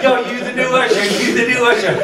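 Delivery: high-pitched, hurried mock chant; excited acclaim. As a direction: hail him like a cheering entourage. Entourage hoists him up, chanting.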